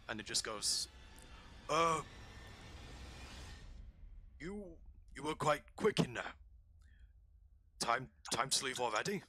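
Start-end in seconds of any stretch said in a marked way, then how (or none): 1.7-9.2 orc guard voice. Acted.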